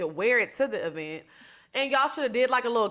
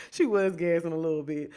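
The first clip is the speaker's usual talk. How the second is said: ordinary talk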